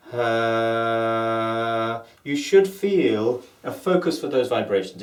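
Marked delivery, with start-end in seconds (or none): 0.1-2.0 long held steady huh; sustained huh. Shown as a demonstration. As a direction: hold the huh on one note. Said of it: "as you sustain that voice".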